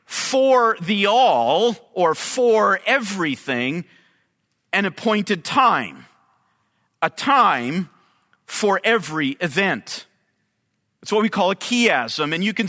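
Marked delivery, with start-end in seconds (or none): 0.1-10.1 each word set apart, slow and deliberate; slow literal reading. Shown as a demonstration. Preacher slowly reading a literal translation to his congregation, deliberate. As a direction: read out the literal Hebrew wording slowly. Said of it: "translate it directly from the Hebrew in this way".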